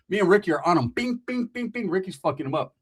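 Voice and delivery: ordinary delivery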